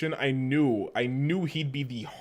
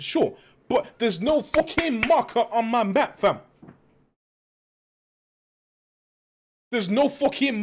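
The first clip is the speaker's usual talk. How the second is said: put-on higher, louder British streamer accent, held throughout; 6 semitones higher, 4 dB louder than usual. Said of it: "my K S I accent".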